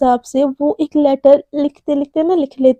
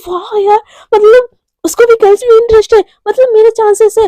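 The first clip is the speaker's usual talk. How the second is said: excited; 8 semitones higher, 6 dB louder than usual. Acted. Excited crush hoping for chances.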